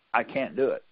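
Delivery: ordinary talk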